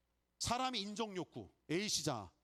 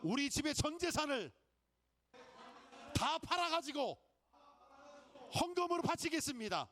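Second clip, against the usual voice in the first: call and response; 10 semitones higher than usual. Shown as a demonstration. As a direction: proclaim it big so they echo it. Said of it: "제가 하는 대로 따라주세요".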